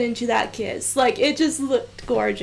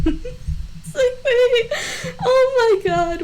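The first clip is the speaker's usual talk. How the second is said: words tumbling out fast, then drawn out in teary laughter; half sobbing, half giggling; laughing crying; 9 semitones higher, slower than usual. Real, not acted. Overcome while reacting to a scene.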